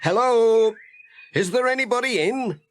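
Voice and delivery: high calling postman; Pat calling out. Acted. Postman calling at the farm door, high and questioning.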